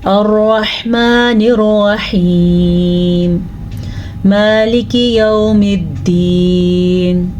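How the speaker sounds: slow, drawn-out melodic tajweed recitation; Quran recitation. Shown as a demonstration. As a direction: chant each verse slowly with long held vowels. Full tajweed recitation of Fatiha. (سست، لمبی آواز میں تجوید کے ساتھ تلاوت)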